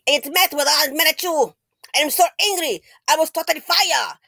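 mock angry child. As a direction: imitate a tantruming boy. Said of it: "he got mad at his mommy".

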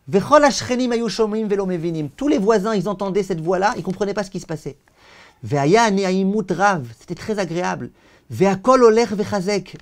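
loud, declaiming reading of the sacred text with translation; fervent text reading. Acted. Teacher reading the heavenly voice's message to students, declaiming. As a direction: proclaim the heavenly voice's words to the class.

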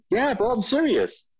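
high mock friend assuring; friend's voice. Friend confirming the offer, high imitated voice. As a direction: play the friend dead serious. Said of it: "He goes".

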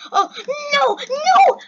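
two high, loud cries; panicked shout. Real, not acted. Player falling while wanting the badge, panicked shout. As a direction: shout "no" in alarm.

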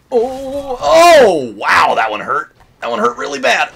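loud, high reaction to a heavy hit; pained astonishment. Real, not acted. Player hit really bad, loud stunned outcry. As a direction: exclaim it as if it hurt.

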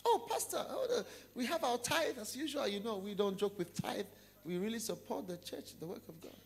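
high, laughing, gushing greeting; high, laughing tithe-payer greeting the pastor; fawning churchgoer. Acted. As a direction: flatter the pastor while showing off. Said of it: "on church on Sunday".

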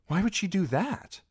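high, surprised question; startled. Acted. Arya hearing of the aunt's death, startled. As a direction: blurt it in shock. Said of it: "said Arya, startled".

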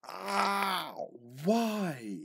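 high, loud gamer yelp; pained yelp. Real, not acted. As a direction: cry out in pain.

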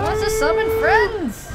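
loud, slow, high warning; alarmed call. Real, not acted. Warning a teammate about the summoning enemy, loud call. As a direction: shout the warning to the team. Enemy calls for help in game.

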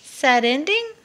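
high, loud squeaky question; a little mouse piping up. Acted. Mouse asking the crying dragon, high and squeaky.

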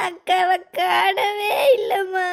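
slow wailing cry over missing sister; girl crying to mother that her sister is lost. Acted. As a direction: sob it out slowly. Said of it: "நாங்க அழுதுட்டு வரேன்".

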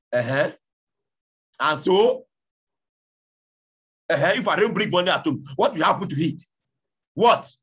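angry husband. Acted. Husband retorting to his complaining wife, loud and rising. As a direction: fire it back at her, building to a yelled what.

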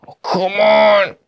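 drawn-out annoyed protest; frustrated outcry. Real, not acted. Frustration in game.

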